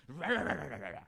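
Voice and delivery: loud garbled mock shouting. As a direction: imitate a stranger shouting gibberish. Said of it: "started shouting".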